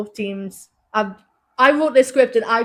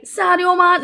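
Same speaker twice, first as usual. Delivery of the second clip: high, punchy Scouse cry; intense Scouse accent; 8 semitones higher, 4 dB louder, slower than usual. Shown as a demonstration. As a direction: demonstrate Scouse with more intensity. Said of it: "like, more intensity".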